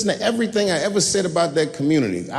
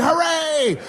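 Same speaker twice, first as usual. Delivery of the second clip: crowd cheer; 12 semitones higher than usual. Retold or imitated. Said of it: "the whole crowd said".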